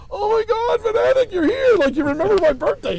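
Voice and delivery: loud, very high-pitched gushing; hammed-up excited shriek; overjoyed big simple guy. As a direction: imitate a starstruck man squealing at his idol. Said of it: "That four hundred pound guy who was like".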